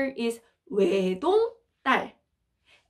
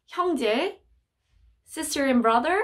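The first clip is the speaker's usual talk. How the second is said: usual speaking voice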